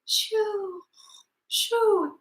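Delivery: soft high whispered shoo; soft hushing whisper. Shown as a demonstration. Teacher to kids, a whispered sleepy shoo. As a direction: hush like everyone's asleep. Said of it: "when we are all asleep".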